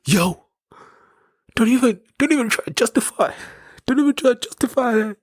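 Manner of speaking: very high falsetto mimicry; imitating taxi-rank guy. Retelling a well-dressed guy at the taxi rank arguing, high-pitched imitation. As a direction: squeak it out as a mock scolding. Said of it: "I swear he said that".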